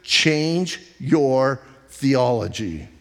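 each word slowed and weighted, "change" hit hardest; slow, deliberate stress on "change"; slow emphatic command. Shown as a demonstration. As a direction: land "change your theology" like a verdict. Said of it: "And listen carefully".

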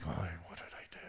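a hushed "what did I do" from someone imitating a nervous person; worried imitation. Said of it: "And they think".

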